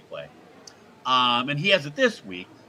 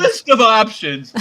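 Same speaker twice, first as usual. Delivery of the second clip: mock repeat; 5 semitones higher, 9 dB louder than usual. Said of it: "Lex is like".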